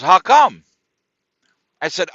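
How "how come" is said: suddenly loud, flat demand; blunt challenge. Retold or imitated. Homeless man challenging the complainer, loud. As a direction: bark it back at him. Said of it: "he goes".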